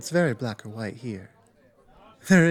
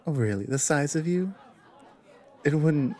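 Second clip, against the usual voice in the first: ordinary delivery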